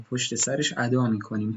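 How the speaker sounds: ordinary talk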